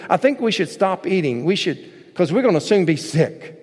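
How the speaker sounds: ordinary delivery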